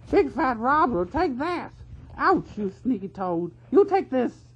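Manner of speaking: high, loud yelling guards; furious weasel brawl. A narrator's reading. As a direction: swing and shout in a scuffle. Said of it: "turned furiously upon each other".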